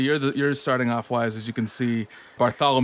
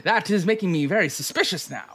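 high, fast, suspicious character voice; suspicious toll collector; 4 semitones higher, faster than usual. Acted. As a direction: play the wary toll collector, hurried and high.

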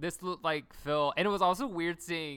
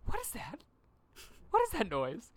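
high annoyed Raven; 9 semitones higher than usual. Retold or imitated. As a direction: demand to know what the racket is. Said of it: "And Raven goes".

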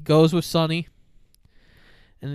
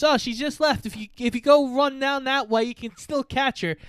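higher mock gangster; 8 semitones higher than usual. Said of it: "And he goes".

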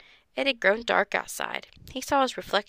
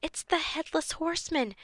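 a hushed high scream of alarm in a friend's ear; mock-scared high cry; frightened scream; 9 semitones higher, 5 dB quieter than usual. A narrator's reading. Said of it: "Ruth Rose screamed".

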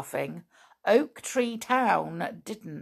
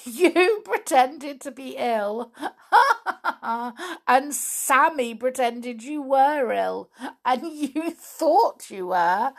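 high, laughing, slower; through laughter; 5 semitones higher, 4 dB louder, slower than usual. Acted. Flip teasing Binkle, laughing through it. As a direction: giggle through the list. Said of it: "went off into peals of laughter".

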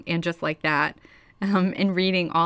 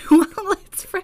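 high, laughing disbelief; 12 semitones higher, 5 dB louder than usual. Real, not acted. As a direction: ask while still giggling. Laughing while asking.

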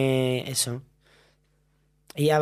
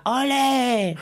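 high cheer, imitating Belén Esteban; playful, higher mock "Ole" cheer; mock cheering shout; 8 semitones higher than usual. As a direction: call out like a fan in the stands. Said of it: "gritaba cosas".

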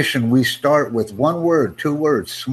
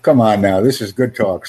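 usual speaking voice

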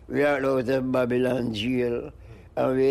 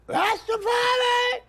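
slow falsetto crowing of Rastafari; rooster crow. Pretend. Crowing like a rooster for the interviewer, slow falsetto. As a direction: crow it out high and stretched like a rooster. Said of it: "says my wood in Hollywood".

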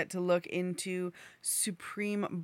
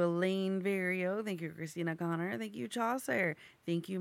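ordinary delivery

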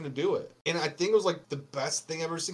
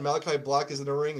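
ordinary talk

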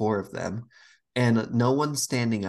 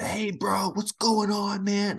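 high, mock bro voice; bro greeting; 10 semitones higher, slower than usual. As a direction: play the overeager gamer bro, pitched up. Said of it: "they're like".

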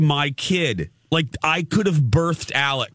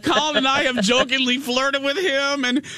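high, teary laugh-crying through the words; laughing to tears; 11 semitones higher than usual. Real, not acted. Host still laughing to tears at the memory, high and teary. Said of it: "I literally am crying right now".

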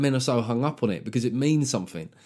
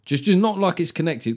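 usual speaking voice

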